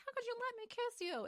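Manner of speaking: very high-pitched mock shriek; imitating an outraged screech; mock scream. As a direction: squeal it high without real volume. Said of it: "her screaming at him".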